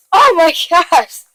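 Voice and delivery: hammed-up motherly shock; shocked mom. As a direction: stretch it out in mock horror. Acted reaction of Kyle's mom.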